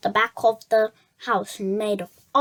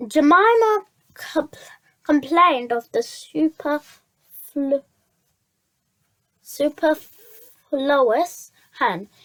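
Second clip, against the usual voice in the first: ordinary delivery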